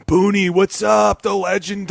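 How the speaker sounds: hyped greeting. Retold or imitated. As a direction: stretch it out like a big hello. Quoting Russell's greeting.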